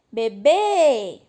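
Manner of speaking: Bebê with BÊ loud and held long; stress on final syllable. Shown as a demonstration. Teacher showing an easy oxytone example, stressed Bebê. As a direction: call out Bebê, stretching the final bê. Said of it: "nem precisava chamar no portão".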